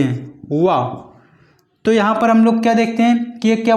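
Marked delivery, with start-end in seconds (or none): 0.6-0.8 वा said with lower lip on upper teeth; वा as a labiodental v; demo of va. Shown as a demonstration. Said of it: "जब भी हम इस वा को बोलते".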